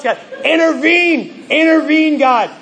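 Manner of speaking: high, pleading cry to God. Retold or imitated. Preacher crying out in prayer.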